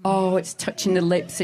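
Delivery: ordinary talk, nothing marked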